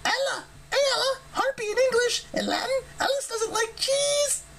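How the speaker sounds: very high, crying harpy babble; high squawking harpy voice; breathless harpy. A narrator's reading. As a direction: rattle it off like a frantic bird. Ella rattles on without breath.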